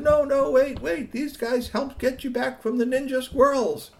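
high, quick calming plea; urgent reassurance. Acted. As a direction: hold him there and reassure fast. Kids calming Squeaky.

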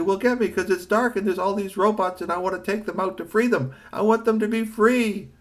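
high robot voice wanting freedom; a robot asking to be fetched; lightly voiced robot.

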